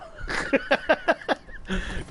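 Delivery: airy high-pitched giggle. Real, not acted. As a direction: giggle at your own joke. Laughing after embarrassing demo.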